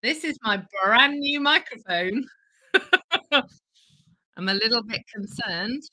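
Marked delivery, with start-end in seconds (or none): 2.7-3.3 short high nervous laugh; a quick embarrassed giggle. Real, not acted. Laughs at new microphone.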